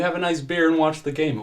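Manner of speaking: hurried, placating cop voice; officer placating. Acted. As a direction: coax him into going home. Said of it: "Earl says".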